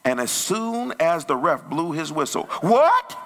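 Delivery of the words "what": high, loud yelp of disbelief; mock outraged parent. Distracted parent hears the ref's whistle, mock outrage. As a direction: shoot up high into an indignant question.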